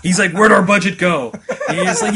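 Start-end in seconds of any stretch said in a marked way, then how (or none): 0.3-1.3 raised, loud mock outrage; imitated indignant game character; Deadpool. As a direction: complain loudly through a laugh. Acting out Deadpool complaining about budget.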